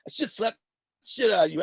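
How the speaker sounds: hammed-up warning to the poking kid; mock threat. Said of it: "I'm like".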